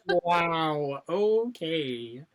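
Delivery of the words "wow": ordinary talk, nothing marked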